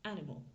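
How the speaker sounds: "animal" in English, first syllable stressed; English pronunciation. Shown as a demonstration. Teacher demonstrating word stress to learners, English "animal" stressed on AN. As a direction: say "animal" the English way, AN-i-mal, with the ending fading. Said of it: "l'accent tonique est sur ma première syllabe".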